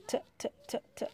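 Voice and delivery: T répété, détaché; répéter le son T en cherchant; sounding out T. Shown as a demonstration. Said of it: "Je regarde mes étiquettes". (son T répété)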